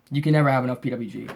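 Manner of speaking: normal talking voice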